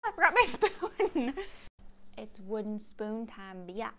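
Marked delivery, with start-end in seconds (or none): 0.0-1.3 high, loud, laughing; laughing exclaim. Real, not acted. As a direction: laugh it out, flustered. Laughing at forgetting spoon.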